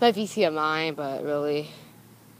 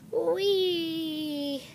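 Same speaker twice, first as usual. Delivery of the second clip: playful whee; 9 semitones higher than usual. Real, not acted. Playful exclamation.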